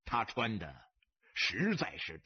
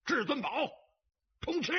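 high, punched winner's cry; high-pitched gloating yell; loud triumphant shout; 11 semitones higher, faster than usual. A narrator's reading. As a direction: bellow the winning hand to the whole table. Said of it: "大声喝道".

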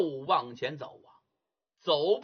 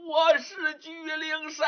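higher shouted claim, straining; ghost's shout; 9 semitones higher than usual. Acted. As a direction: cry it out while climbing again. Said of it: "一边爬一边喊".

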